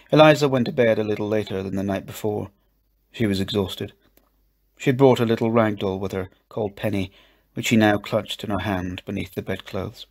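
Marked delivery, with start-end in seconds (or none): none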